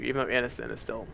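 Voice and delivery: ordinary talk, nothing marked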